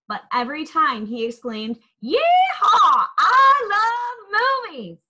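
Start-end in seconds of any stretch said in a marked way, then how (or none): 2.0-5.0 booming cowboy yell, high and loud; big joyful cowboy shout; too loud. A narrator's reading. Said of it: "his words came out too loud".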